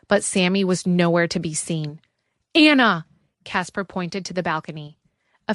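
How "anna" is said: high, loud urgent call. A narrator's reading. Casper warning Anna of danger, loud call. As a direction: call her name to warn her.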